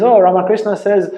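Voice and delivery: a playful challenge to the teacher, quick and loud; playful teasing question. Retold or imitated. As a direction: toss it out playfully and fast. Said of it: "kind of playfully asks them".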